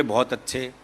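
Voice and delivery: low, soft murmured praise; quiet approval. Retold or imitated. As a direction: murmur it softly, pleased. Parents approving child's commentary.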